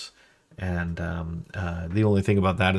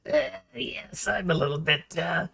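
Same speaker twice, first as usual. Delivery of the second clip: high whispery crazed rasp that swells; crazy Junkrat, much higher; 9 semitones higher than usual. Retold or imitated. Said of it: "sort of a Junkrat voice for crazy people".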